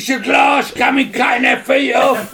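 laughing shout. Real, not acted. Telling friends the flood story, laughing shout.

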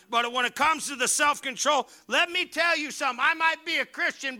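high, loud preaching; full-force preaching. Acted. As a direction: fire up the congregation.

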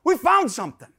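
put-on high excited child shout; kids yelling. Small sons calling Dad out from under the car, yelling. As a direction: yell it like little boys bursting with news. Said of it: "they're yelling to me".

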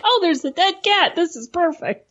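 hammed-up thrill at a lucky find; mock delighted find. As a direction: play someone gleefully spotting treasure. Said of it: "So someone's like".

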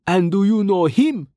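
high, pointed question; urgent, high-voiced challenge; hissed retort. A narrator's reading. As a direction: throw the question back, voice pitched high. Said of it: "Brovos hissed back".

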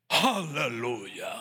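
low, slow, booming aleluya; deep drawn-out aleluya. Retold or imitated. Mocking the pastor's pulpit aleluya. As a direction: stretch it deep like a showy preacher. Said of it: "cambió hasta la voz".

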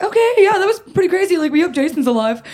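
very high, loud play-acted kid chatter; hammed-up okay, pitched sky-high then easing down; mock breezy agreement. Kids answering dad after fleeing mom, mock breezy. Retold kids' overbright reply to dad.

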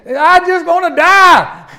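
very high, loud, drawn-out mock wail; child pitching a fit. Child denied candy at checkout, hammed-up tantrum. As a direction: wail melodramatically like a thwarted kid. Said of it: "he pitches a fit".

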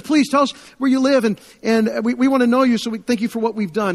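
high and loud, pleading to give back; eager pleading. Retold or imitated. Refugee pleading with her rescuer, eager and grateful. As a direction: press him gratefully to say where he lives.

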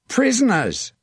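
high, falling cry across a battlefield; shouted call. A narrator's reading. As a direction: sing out the claim in a high shout. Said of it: "he shouted".

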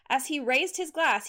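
ordinary delivery, nothing marked

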